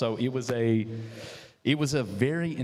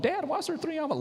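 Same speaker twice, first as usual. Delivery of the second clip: high mock kid voice, much higher pitch; imitating a child; faster than usual. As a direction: play his young kid asking, puzzled. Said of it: "your kids are coming home from school going".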